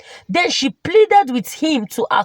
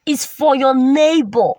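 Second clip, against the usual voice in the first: ordinary delivery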